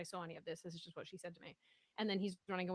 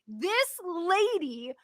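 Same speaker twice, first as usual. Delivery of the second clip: louder, much higher, slow furious rant; angry rant; 10 semitones higher, 13 dB louder, much slower than usual. Retold or imitated. Ranting to Jeremy on the phone about the lady, furious and loud. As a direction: vent your outrage to your partner, full volume. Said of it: "this is why I called Jeremy to rant".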